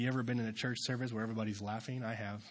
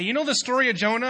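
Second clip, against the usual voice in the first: eager question; 11 semitones higher, 12 dB louder, faster than usual. Retold or imitated. Quoting himself to students.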